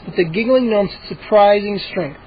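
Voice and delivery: ordinary delivery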